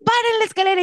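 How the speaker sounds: high mock shout; imitated crowd shout. Gamarra shoppers yelling to stop the escalator, high mock shout.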